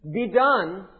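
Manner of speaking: loud, forceful command; booming, decisive decree; imperative. Shown as a demonstration. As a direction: hit it hard as an order. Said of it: "Both in the imperative".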